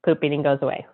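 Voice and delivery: ordinary talk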